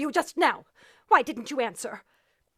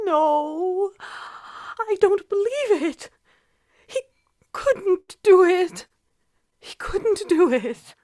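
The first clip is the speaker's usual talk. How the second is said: dazed disbelief; much slower than usual. Acted.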